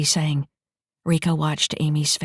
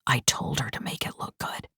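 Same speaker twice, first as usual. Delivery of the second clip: whisper; 3 semitones lower, 9 dB quieter than usual. Acted. Injured man on private link.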